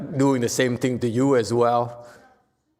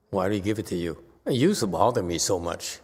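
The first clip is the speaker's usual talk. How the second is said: normal talking voice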